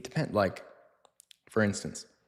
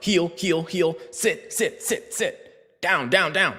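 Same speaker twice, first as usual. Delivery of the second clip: high, urgent repeated commands; mock-frantic, raised-pitch commands; frantic dog handler; 7 semitones higher, much slower than usual. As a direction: mimic a panicky owner firing off orders. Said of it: "you're like".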